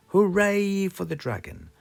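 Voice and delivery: usual speaking voice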